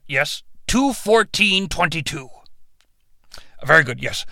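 a hint of a deep god politely on hold; god calling his legal department; dragon god on the phone.

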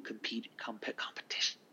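hushed whisper. Real, not acted. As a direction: whisper it to yourself. Whispered aside about rivals.

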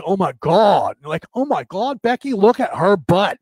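hammed-up SoCal accent, stretched vowels; Southern California accent. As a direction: imitate a Southern California valley talker. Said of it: "That's South, um, uh, California".

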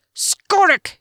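high, loud furious yell. A narrator's reading. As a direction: yell the curse while slashing. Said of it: "he yelled".

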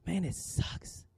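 hushed admission. Real, not acted. Husband admitting to the church, in a hushed near-whisper. Husband confessing painful season.

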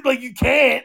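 usual speaking voice

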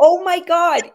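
high, loud retold gasp of joy; excited exclamation. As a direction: burst out with delight. Retells horse remembering her.

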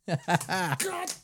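normal talking voice

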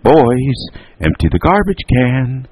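high, ringing mother's call. A narrator's reading. Mother calls her gaming boys, calling out. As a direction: sing the order out to another floor. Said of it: "Alice called down".

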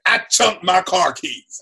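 ordinary talk, nothing marked

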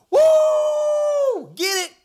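a high, loud "Woo!" of delight; excited cheer. Real, not acted. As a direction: whoop like a fan in the stands. Cheering the doctor on.

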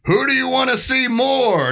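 high, drawn-out call to the crowd; festival host on mic. Retold or imitated. Organizer asking the crowd to choose a band, high hyped-up call. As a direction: imitate someone working up an audience. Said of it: "He grabs the mic".

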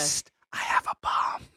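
breathy mock whisper. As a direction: hiss it like a secret. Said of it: "he's like".

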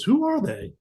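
put-on high, light teen voice asking; quick curious kid's question; imitating his son. Son asking dad about the guys, lightly voiced teen.

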